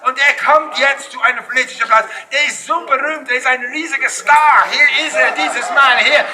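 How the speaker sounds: ordinary talk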